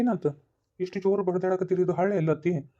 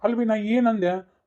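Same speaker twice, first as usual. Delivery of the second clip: exasperated protest; 6 semitones higher, 4 dB louder, much slower than usual. Acted. Son defends himself to mother.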